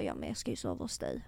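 very low and soft, quickened; low retold reply. Friend retells a date inviting himself over, low mutter. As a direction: mutter his reply under your breath.

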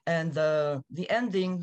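ordinary talk

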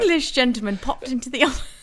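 put-on high, loud, quick English accent; mock English accent. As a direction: mimic the English accent teasingly. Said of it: "with an English accent".

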